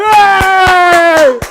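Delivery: high, loud whoa of shock; crowd gasp whoa. Retold or imitated.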